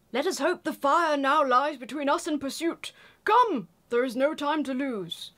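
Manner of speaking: urgent wizard call, Come rising high; Gandalf reading. Acted. Reading Gandalf urging the fellowship to flee. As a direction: urge the company onward.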